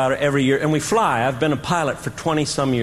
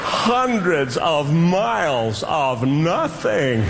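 very slow, stretched-out complaint; drawn-out exasperation; 2 semitones higher, much slower than usual. Real, not acted. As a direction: stretch every word like an endless road. Complaining about endless Kansas drive.

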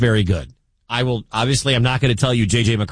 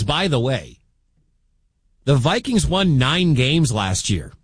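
normal talking voice

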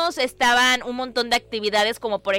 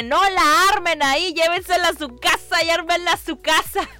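higher, lightly raised mock crowd yell; imitating crowd shouting; 6 semitones higher than usual. Host imitating people yelling in the Lego line, mock shouting. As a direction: call out like an impatient crowd, half in fun. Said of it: "empezaba a gritar la gente".

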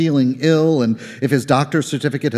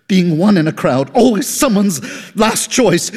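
anguished plea; 8 semitones higher, 4 dB louder than usual. Acted. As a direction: let the hurt climb up and out. Caller's plea rising.

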